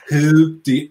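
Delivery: brief mock Trump accent; Trump impression. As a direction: start the line as Trump would, half-committed. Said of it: "this is my Trump accent".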